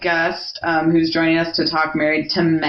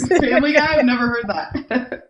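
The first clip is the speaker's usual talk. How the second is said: laughing surprise; 6 semitones higher, 4 dB louder than usual. Real, not acted. Host laughs at the guest linking Rhode Island to Family Guy, laughing surprise. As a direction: laugh it out, caught off guard.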